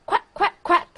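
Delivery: bright barnyard duck quacks. Acted.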